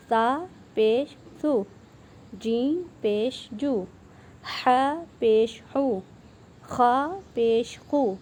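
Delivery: slow, clear syllable-by-syllable reading; recitation drill. Shown as a demonstration. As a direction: read each letter slowly like a teacher. (آہستہ واضح ہجے)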